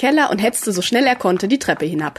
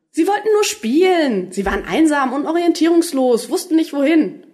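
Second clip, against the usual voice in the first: pleading defence; 7 semitones higher than usual. A narrator's reading. Doctor defends the small creatures.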